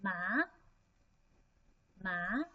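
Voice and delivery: MA rising at the end; second tone. Shown as a demonstration. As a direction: lift MA upward like a question. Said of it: "the second tone".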